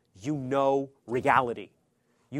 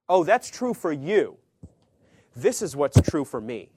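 higher, louder, dismissive mimic; mocking postmodern; 3 semitones higher, 8 dB louder than usual. Preacher mocking the postmodern person's shrug, higher and louder. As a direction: mimic a breezy relativist. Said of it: "they will believe in their truth".

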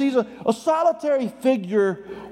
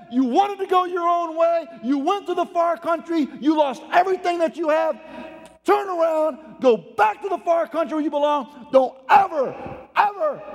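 acted indignant tirade, pitched up; angry father; 6 semitones higher than usual. Father rebuking his returning son, angry and high-pitched. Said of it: "begins to get angrier and angrier".

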